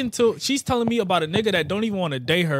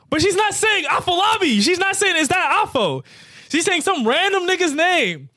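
much higher and louder, outraged; a loud, high, worked-up punchline; indignant shout; 12 semitones higher, 4 dB louder than usual. Real, not acted. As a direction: yell the punchline in disbelief. Punchline outrage, friends laughing.

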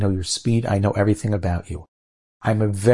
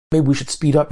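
higher-pitched anxious suggestion; panicking; 6 semitones higher than usual. Acted. Frightened passengers to their guide in turbulence, panicking. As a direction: blurt it out in mock panic. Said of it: "and they're panicking".

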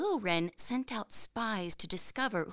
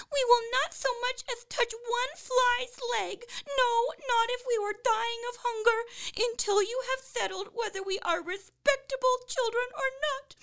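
very high, squeaky chick voice; crying, screaming wrens; 4 dB louder than usual. A narrator's reading. Young wrens refuse food from parents, squealing. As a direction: wail indignantly as tiny birds. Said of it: "continued to cry and scream".

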